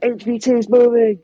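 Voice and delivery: high-pitched call-out, re-enacted; retold yell. Player alerts team that target moves, retold yell. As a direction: imitate calling a warning across the field. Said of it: "and I'm yelling".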